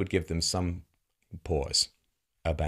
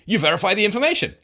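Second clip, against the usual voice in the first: mocking repeat; 10 semitones higher, 7 dB louder, faster than usual. Mocking Scott's circular answer.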